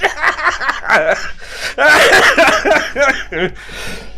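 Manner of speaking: long high-pitched laughing fit; loud high laugh. Real, not acted. Big laugh after story.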